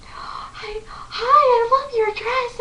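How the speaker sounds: very high squeal imitating a star's hello; gasped hi, then a loud, drawn-out high compliment; retold gushing greeting. Singer walks up to a crying fan, high gushing greeting.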